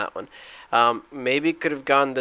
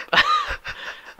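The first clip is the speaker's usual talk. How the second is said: shrill laugh. Real, not acted. Laughing at his own despair.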